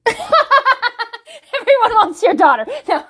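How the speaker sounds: high, slow, laughing through the words. Real, not acted. Vlogger laughing at her own blunder, high and slow. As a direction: crack up mid-line.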